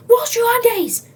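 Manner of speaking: high, loud shout; yelling. Acted. Employees yelling at the smelly customer, loud shout. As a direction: bark an order across the shop.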